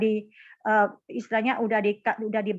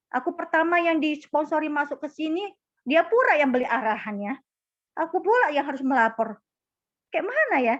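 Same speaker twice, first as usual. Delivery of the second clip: higher-pitched aggrieved appeal; retold protest; 5 semitones higher than usual. Employee explaining her predicament to her superior, mildly aggrieved. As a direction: plead your case in mild protest.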